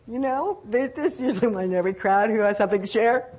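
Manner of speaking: ordinary talk, nothing marked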